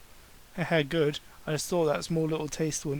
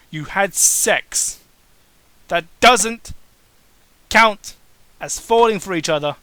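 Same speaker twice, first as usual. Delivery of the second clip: indignant rant; 7 dB louder, slower than usual. Real, not acted. Ranting at the story's logic.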